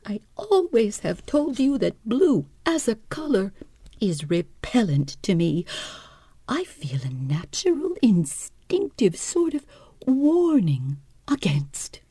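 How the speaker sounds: high, plaintive invalid voice; a nervous hypochondriac wife, complaining; fretful wife. Acted. Wife's complaint.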